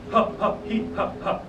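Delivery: sharp breathy ha ha pulses; diaphragm breath pulses. Shown as a demonstration. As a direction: punch each ha from the belly. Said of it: "they should be coming from right here".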